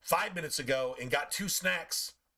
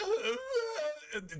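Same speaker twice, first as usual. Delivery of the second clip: whiny dog cry. Retold or imitated. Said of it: "He'll be like".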